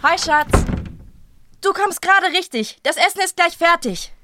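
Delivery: high, bright welcome home; lively domestic hello; cheerful greeting. Acted. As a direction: call out to your sweetheart. Partner calls greeting to homecomer.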